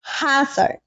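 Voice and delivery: plain reading voice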